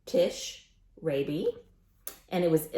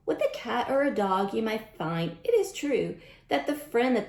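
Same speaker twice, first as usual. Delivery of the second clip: lively picture-book read-aloud; sing-song storytime rhyme; reading to children; 3 semitones higher, 3 dB louder than usual. A narrator's reading. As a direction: read the rhymes brightly to a class.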